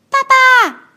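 高声尖叫着喊爸爸; 小孩子扑过去大喊; 宝宝喊爸爸. Acted. 小恐龙回来喊爸爸. (小恐龙高声大喊爸爸)

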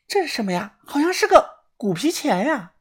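high, put-on girl's voice; curious young woman. A narrator's reading. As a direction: pipe up high, puzzled by a find. Girl's curious find, voiced by narrator.

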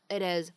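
"it is" run together with a flapped t; American flap T joining "it is" into "ih-diz"; linked "it is". Shown as a demonstration. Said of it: "连读可以读成".

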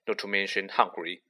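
plain reading voice

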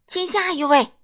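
very loud, high yelling; roaring shout. A narrator's reading. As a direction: bellow at the customer. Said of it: "水果店老板大吼大叫". (大吼大叫)

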